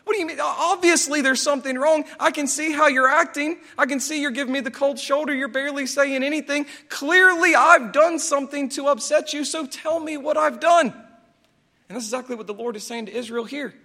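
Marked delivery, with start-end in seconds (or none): 0.1-10.9 higher, louder pleading, rising to near shouting; exasperated husband. Retold or imitated. Husband to cold wife, higher, louder, exasperated pleading. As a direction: press her hard to just say it. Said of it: "you're like".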